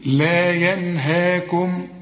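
"لا ينهاكم" very slowly, stretched "ha"; long alif after ha. Shown as a demonstration. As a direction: draw out each long vowel in "لا ينهاكم". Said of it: "وفي نهاية الهاء ده".

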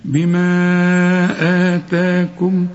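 بما آتاكم with the vowels stretched very long, four beats; long elongation. Shown as a demonstration. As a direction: hold the madd very long and slow. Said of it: "هتمد أربع حركات هنا".

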